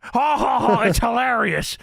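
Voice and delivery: high mock guffaw into the words; hammed-up hilarity. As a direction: imitate a crazy, overdone laugh. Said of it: "J R is laughing at this recap".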